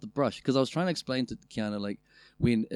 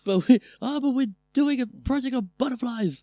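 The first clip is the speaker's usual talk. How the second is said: put-on high, loud kid voice about a school project; a child announcing a school project; 14 semitones higher, 7 dB louder than usual. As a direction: play an eager schoolkid, pitched up and loud.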